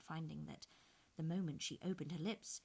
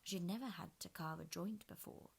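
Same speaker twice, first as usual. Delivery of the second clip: ordinary delivery, nothing marked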